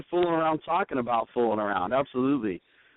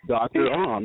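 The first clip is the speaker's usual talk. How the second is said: Bond villain voice; 14 dB louder, much slower than usual. Pretend. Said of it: "sound like a character from a James Bond movie".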